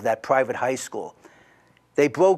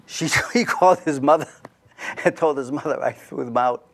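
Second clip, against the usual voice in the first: laughing while telling. Real, not acted. Laughing at the absurd outcome.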